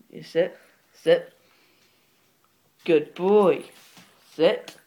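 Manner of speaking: loud, sharp dog commands; firm commands. Real, not acted. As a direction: order the dog to sit, loud.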